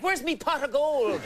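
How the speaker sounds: high Irish leprechaun voice; squeaky little Irish leprechaun. Pretend. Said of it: "they see me and they're like".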